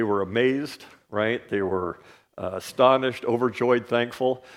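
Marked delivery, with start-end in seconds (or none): none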